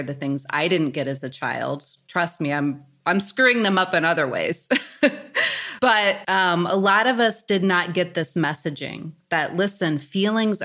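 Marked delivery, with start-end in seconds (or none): 4.7-5.8 laugh. Real, not acted. Laughs at her own joke.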